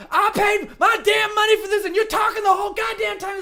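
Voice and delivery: very high, loud, ranting shout; full-voiced shrieking rant; furious yelling. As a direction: imitate an outraged woman screaming at strangers. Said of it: "she's yelling like this".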